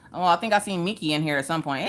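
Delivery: ordinary talk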